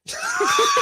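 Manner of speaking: very high laugh burst. Real, not acted. Co-host admits he is worn out, high laughing. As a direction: break into high, helpless laughter. Cracking up over being tired.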